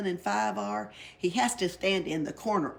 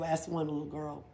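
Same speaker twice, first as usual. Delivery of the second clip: plain reading voice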